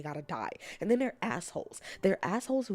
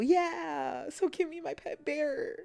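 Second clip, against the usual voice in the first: higher, slow pleading whine; excited whine; 8 semitones higher, slower than usual. Real, not acted. Streamer whining to chat for her pet bear license.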